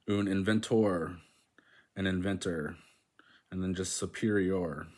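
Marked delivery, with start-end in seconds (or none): none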